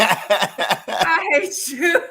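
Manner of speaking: helpless laughter through the words; slow, drawn out through real laughing; laughing mock hatred. Real, not acted. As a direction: tease back while cracking up. Teased host laughs back at guest.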